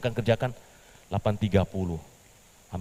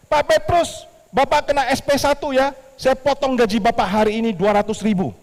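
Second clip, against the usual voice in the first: much higher, louder reprimand; scolding boss; 9 dB louder than usual. Acted. The boss scolding Petrus for being late, loud. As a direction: dock his pay sharply.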